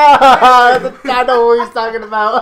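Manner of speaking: loud, high-pitched words through laughter; laughing hard. Real, not acted. Teasing a friend over his phrase, laughing hard. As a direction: barely get it out for laughing.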